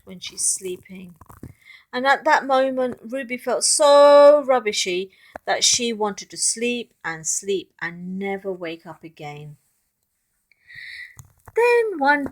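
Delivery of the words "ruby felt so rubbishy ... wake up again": ordinary delivery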